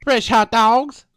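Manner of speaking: loud drawn-out vendor cry; street vendor calling. Pretend. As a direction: hawk fresh hot dogs slowly. Playing hot dog vendor.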